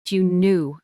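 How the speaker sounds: usual speaking voice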